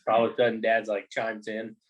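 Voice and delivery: usual speaking voice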